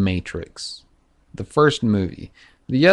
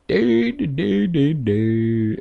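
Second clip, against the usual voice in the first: high sing-song noises that drop in pitch; clueless doodling hum; 7 semitones higher, much slower than usual. Acted. Storyteller mimicking Adam and Eve, mock clueless sing-song. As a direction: vocalise mindlessly, starting very high and sliding down.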